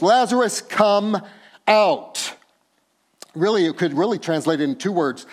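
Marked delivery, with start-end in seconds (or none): 0.0-2.3 loud, higher call; loud cry. Acted. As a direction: call him out of the tomb. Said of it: "he cries out with a loud voice".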